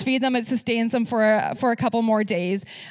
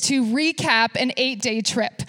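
ordinary talk, nothing marked

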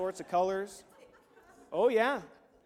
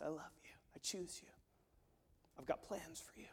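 tender whisper; 2 semitones lower, 16 dB quieter than usual. Acted. God speaking tenderly to sinner.

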